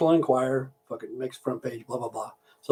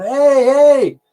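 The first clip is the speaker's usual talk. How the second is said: high, loud protesting yell; pained, high-pitched holler; retold yelling; 10 semitones higher, 7 dB louder than usual. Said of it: "fucking yelling and screaming".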